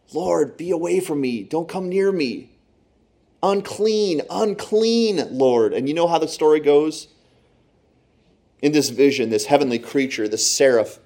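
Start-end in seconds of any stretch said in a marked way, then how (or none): 0.1-5.7 high, slow, pleading cry of unworthiness; a sinner crying out before God, high and slow; anguished plea. Acted. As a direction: beg him to stay away, voice lifted in anguish. Isaiah begs Lord to leave.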